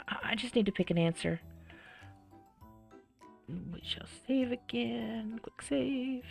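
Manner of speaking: ordinary talk, nothing marked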